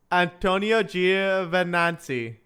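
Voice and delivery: "Antonio Giovinazzi" said slowly, at an even pitch; careful slow attempt. Shown as a demonstration. Streamer retrying a hard driver's name, slow and careful. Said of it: "I'll try to say it".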